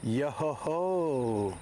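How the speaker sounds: slow drawn-out pirate chant; sing-song it like a pirate. Pretend. Pirate chant on treasure hunt.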